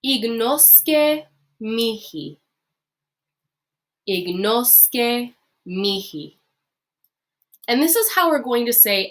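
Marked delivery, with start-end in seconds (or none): 0.1-6.4 ignosce mihi said very slowly and clearly; slow model phrase. Shown as a demonstration. Teacher modelling ignosce mihi for the class. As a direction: draw out ignosce mihi for learners. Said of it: "another little saying here".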